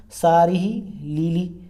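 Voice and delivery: सारी ही lily drawn out slowly, each word spaced; slow mnemonic reading. Shown as a demonstration. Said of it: "Shirui lily को पढ़ लो". (सारी ही lily को धीरे-धीरे, ज़ोर देकर पढ़ें)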